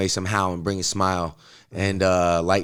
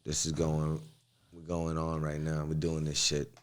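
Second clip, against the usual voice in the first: a little tipsy, quieter; 6 semitones lower, 10 dB quieter than usual. Real, not acted. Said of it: "I'm a little tipsy".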